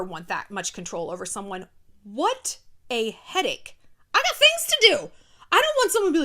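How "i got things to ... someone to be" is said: high-pitched, loud comic protest; exasperated, high and loud; exasperated outburst. Real, not acted. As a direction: protest loudly, voice shooting way up. Comic outburst at controlling someone.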